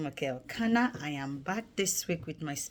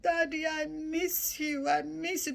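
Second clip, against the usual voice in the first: crying; 10 semitones higher than usual. Retold or imitated. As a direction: sob it out into his arms. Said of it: "I started crying, like".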